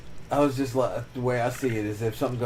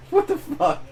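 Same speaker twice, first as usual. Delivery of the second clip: much higher mock disbelief; incredulous; 3 dB louder than usual. Real, not acted. Venting to a friend about his sister, incredulous. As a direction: squeal it in amused shock. Punchline exasperation.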